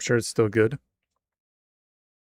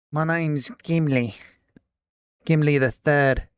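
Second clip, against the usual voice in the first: put-on British accent; 2 semitones higher, 3 dB louder than usual. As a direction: announce yourself like royalty, British accent. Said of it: "faking his American accent".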